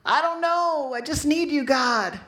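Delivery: a retold plea, half sung and aching; desperate cry to God. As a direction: cry out to God from the end of your rope. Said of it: "all you can muster is".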